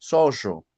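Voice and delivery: put-on "Zorro" with the old highland rr, nearly a buzzy zh; sierra-style double R. Shown as a demonstration. Teacher repeats her old sierra R for students. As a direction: repeat "Zorro" the way she said it as a newcomer. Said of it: "Esa era mi pronunciación de la doble R".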